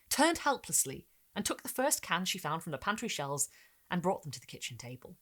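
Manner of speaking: ordinary delivery, nothing marked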